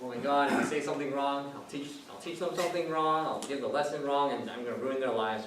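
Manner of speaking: retold freak-out. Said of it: "like freaking out".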